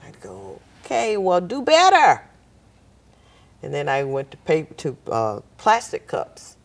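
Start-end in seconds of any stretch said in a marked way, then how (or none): 0.9-2.2 scolding mother. Retold or imitated. Scolding her daughter.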